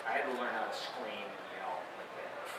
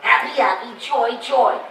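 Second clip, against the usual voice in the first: high, loud, laughing cartoon chant; Stimpy voice; 10 semitones higher, 15 dB louder, slower than usual. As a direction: belt it out gleefully in a cartoon voice. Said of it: "he's always like".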